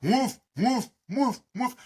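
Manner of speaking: high yappy imitation barks; high dog barks. Imitating the dog barking at a treed bear, high yaps. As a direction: bark like the dog up at the tree.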